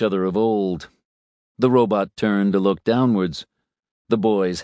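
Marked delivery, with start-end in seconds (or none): none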